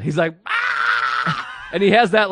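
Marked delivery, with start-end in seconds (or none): 0.5-1.7 high-pitched mock smoker's cackle; weird, high, crazy smoker's laugh; imitated crazy laugh. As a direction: imitate someone losing it laughing. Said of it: "weird smoker's crazy laugh".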